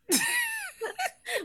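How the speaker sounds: high emotional laugh; overwhelmed high laugh. Real, not acted. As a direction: squeal of a laugh. Overwhelmed by trailer memory.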